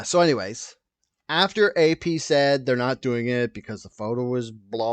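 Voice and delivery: usual speaking voice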